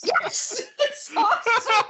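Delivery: high, bubbling with laughter; laughing. Real, not acted. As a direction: laugh through the words. Laughing at the whisper demo.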